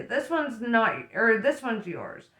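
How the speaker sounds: ordinary delivery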